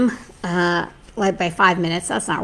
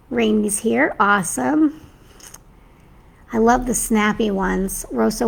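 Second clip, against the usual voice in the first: ordinary talk, nothing marked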